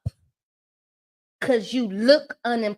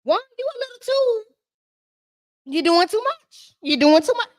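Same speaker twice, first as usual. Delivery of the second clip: high, incredulous scolding; much higher exasperated reproach; 11 semitones higher than usual. Retold or imitated. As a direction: push back on him in disbelief. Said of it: "I'm like".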